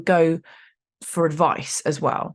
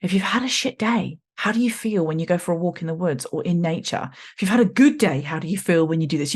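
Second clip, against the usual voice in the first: ordinary talk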